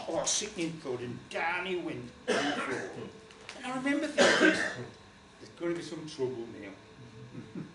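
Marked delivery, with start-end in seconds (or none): none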